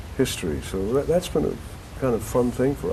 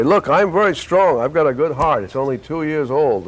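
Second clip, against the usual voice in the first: put-on higher voice of an old man bragging about his strength; boasting old man; 6 semitones higher than usual. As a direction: puff up like a frail old man showing off. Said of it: "I play a guy who's old".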